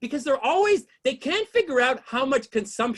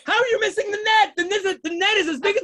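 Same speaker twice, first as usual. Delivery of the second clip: high, raised comic disbelief; incredulous outrage; 5 semitones higher than usual. Acted. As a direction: demand an answer in exasperation. Incredulous rant at soccer players.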